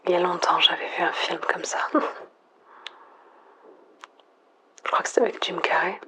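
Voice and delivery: soft, breathy near-whisper into the mic; quiet, intimate monologue alone at night; hushed whisper. Acted. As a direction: whisper as if afraid to break the silence. Lone survivor whispering into mic.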